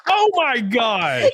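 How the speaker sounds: high, slow, drawn-out exclamation; shocked outcry. Real, not acted. Host reacting to a stranger's message, shocked. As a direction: stretch it out high in disbelief.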